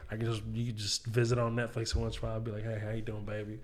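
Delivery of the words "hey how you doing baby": low, hushed greeting; soft sweet-talk. Acted. Comic to his retired material, soft sweet-talk. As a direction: murmur it like to an old flame. Talking fondly to old material.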